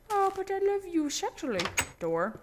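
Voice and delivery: hammed-up high romantic croon; mock sultry voice. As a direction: swoon at the door in a put-on voice. Joking to a door.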